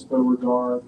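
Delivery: ordinary delivery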